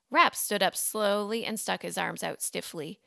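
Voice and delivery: usual speaking voice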